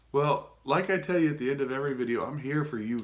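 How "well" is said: ordinary delivery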